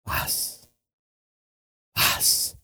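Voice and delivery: whispered, soft and hushed; whispered peace. Retold or imitated. Jesus calming his frightened disciples, whispered. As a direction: breathe it out gently as a whisper. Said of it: "lo primero que Dios dice".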